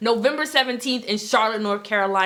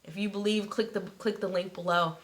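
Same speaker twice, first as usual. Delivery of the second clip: much softer, lower invitation; soft close; 3 semitones lower, 6 dB quieter than usual. Acted. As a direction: wind down into a quiet pitch. Soft closing call.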